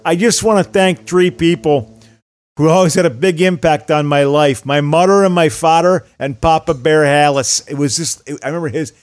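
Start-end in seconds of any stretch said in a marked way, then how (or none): 0.1-7.7 put-on Chicago accent. Butkus thanking his parents at halftime, thick Chicago accent. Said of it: "couldn't have been more Chicago".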